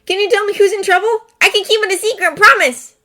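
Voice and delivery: put-on high, quick wheedling kid voice; a boy coaxing for a secret; child bargaining with Astor, high and fast; pleading child. Jamie begging to know.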